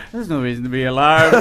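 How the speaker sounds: mock soothing captain voice; imitating the calm pilot through a laugh. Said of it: "I would have been".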